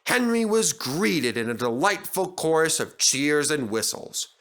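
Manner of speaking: usual speaking voice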